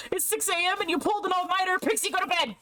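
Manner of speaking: high, fast scolding call; mock scolding. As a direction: tell Pixie off, fast and high. Scolding streamer to sleep.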